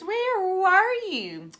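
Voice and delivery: high, loud, slow eager question; eager kids. Acted. Kids ask teacher where she went, eager. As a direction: ask it excitedly.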